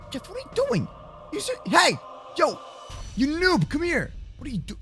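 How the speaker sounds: ordinary delivery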